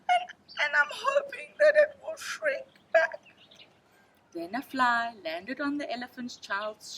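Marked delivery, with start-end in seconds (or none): 0.1-3.2 high, tearful, wobbly whimper; crying. A narrator's reading. Elephant's child crying to snake about his nose. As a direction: sob it out.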